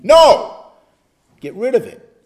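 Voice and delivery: a loud sharp no; shouted no. Retold or imitated. Pastor retelling refusing temptation in the car, loud sharp no. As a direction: slam the door on it.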